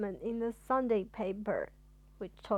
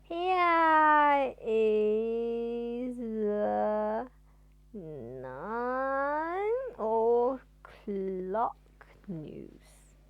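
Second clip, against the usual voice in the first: stretched-out words, dragging every syllable; painfully slow news announcer; extremely slow newsreader; 4 dB louder, much slower than usual. Acted. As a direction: read the news at a crawl. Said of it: "It was very embarrassing".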